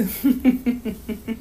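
light amused chuckle; mother's laugh. A narrator's reading. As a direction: chuckle fondly at a child. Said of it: "Mommy laughed".

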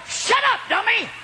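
very high, sharp mock shout; shouted self-rebuke. Preacher kicking himself for his bold promise, shouted self-rebuke. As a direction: yell at yourself to stop talking.

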